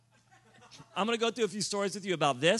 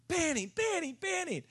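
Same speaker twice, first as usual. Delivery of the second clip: much higher, lightly voiced eager kid; 11 semitones higher than usual. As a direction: call his name like an excited kid. Eager kid calling his name.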